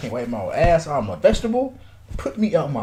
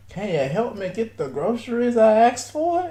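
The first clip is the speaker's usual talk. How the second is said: higher, badly faked Russian lady; weak mock Russian accent, pitched up; terrible Russian accent; 5 semitones higher than usual. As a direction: attempt an old Russian lady's accent. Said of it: "In a Russian accent".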